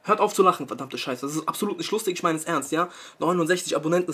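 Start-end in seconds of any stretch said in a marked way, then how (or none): 0.0-1.3 louder, cursing telling-off; angry scolding. Real, not acted. Vlogger angrily scolding viewers who laugh at him. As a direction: tell them off hard.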